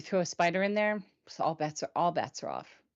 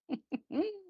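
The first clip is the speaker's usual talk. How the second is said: real high little wheezing laugh; wheezy laugh; 9 semitones higher, 5 dB quieter than usual. Real, not acted. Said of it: "That little wheeze in my laughter".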